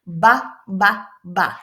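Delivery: "Ba" with a buzzing voiced b. Shown as a demonstration. Teacher showing the noisy b to students. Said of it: "we're going to make some noise".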